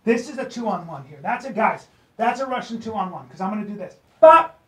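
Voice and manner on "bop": high, loud "bop"; punchy impact sound. Shown as a demonstration. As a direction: pop it out as the move lands. Sound effect for grappling move.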